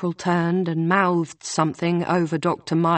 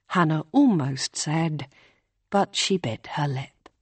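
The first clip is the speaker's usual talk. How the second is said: plain reading voice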